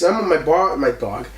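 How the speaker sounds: normal talking voice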